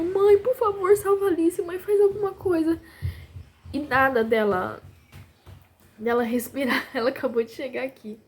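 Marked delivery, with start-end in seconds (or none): 0.0-2.8 much higher, wailing plea, imitated; high-pitched pleading as if crying; retold tearful begging. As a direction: imitate sons begging their mother. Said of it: "começou a chorar, chorar e pedindo".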